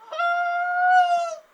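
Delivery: very loud, high, long meow; cat meow. Acted. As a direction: wail it like a cat. Guy imitates a cat.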